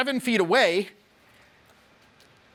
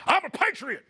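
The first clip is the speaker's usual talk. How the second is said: much higher, laughing proud cry of I'm a patriot; imitating Alex; 9 semitones higher than usual. Coach imitates assistant Alex, high and laughing. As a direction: ham up the proud patriot line through a laugh. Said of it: "Alex here".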